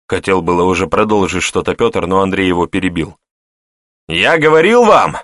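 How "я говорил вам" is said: high, loud rallying cry; loud exclamation. A narrator's reading. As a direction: call out triumphantly to everyone. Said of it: "громко воскликнул он". (громко воскликнуть)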